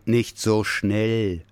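very slow, calm bear voice; slow, soothing bear; slowing him down. Acted. Bear calms the rushing child.

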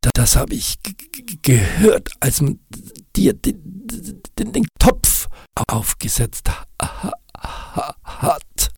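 hoch, stockend mit klappernden Zähnen; klappernd stotternd. Acted. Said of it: "fing auch sein Mund an zu klappern". (Nick stottert zitternd vor Kälte)

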